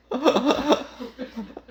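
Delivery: a short high burst of laughter; warm laugh. Real, not acted. Pastor laughing at his crying mother's words, high laugh.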